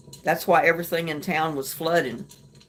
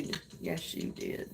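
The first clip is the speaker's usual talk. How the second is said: breathy whisper, barely there; 16 dB quieter than usual. Real, not acted. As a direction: whisper it as a playful secret. Teasing reply to viewer.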